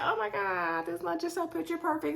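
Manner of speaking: mock gushing, high, soft and dreamy; a sugary swoon, pitched high and hushed; imitating Jeffrey. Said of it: "So Jeffrey's like".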